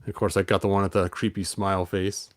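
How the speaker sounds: plain reading voice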